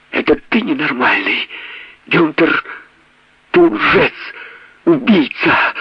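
high, shrill hysterical accusation; working herself up. Acted. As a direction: wind herself up into a frenzy. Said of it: "Она намеренно взвинчивала себя".

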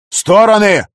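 loud, high barked command; barked order. A narrator's reading. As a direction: bark the order at the workers. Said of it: "рявкнул Виктор".

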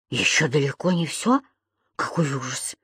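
low, hushed disbelief; quiet dismay, fading away; horrified echo. A narrator's reading. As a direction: shrink back and repeat it softly. Lodger echoes her, horrified.

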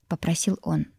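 ordinary delivery

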